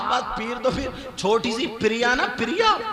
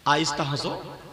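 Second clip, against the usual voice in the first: normal talking voice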